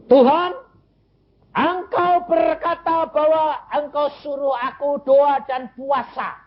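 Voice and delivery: high, impassioned prayer re-enacted; fervent prayer. Father praying to God over his dying child, fervent. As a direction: cry out to God at a high, sustained pitch.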